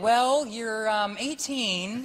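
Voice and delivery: hesitant doctor. Retold or imitated. Retold doctor hedging bad news.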